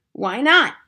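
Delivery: high, loud retort; defiant cry. A narrator's reading. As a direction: throw it back with gusto. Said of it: "she cried".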